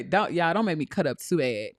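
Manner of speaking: ordinary delivery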